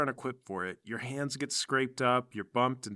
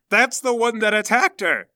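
louder, higher accusing outburst; accusing cry; 7 semitones higher, 7 dB louder than usual. Acted. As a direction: point and accuse the culprit. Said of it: "and she goes".

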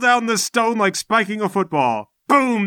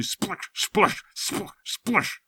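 hushed splashing sounds; 6 dB quieter, much slower than usual. Acted. Narrator imitates splashing behind.

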